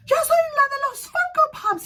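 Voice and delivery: high loud excited Spanish shout; excited call. Retold or imitated. Fan telling a singer who she is, shouted high. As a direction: shout it so he recognizes you.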